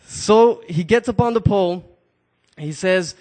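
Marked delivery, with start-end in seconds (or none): none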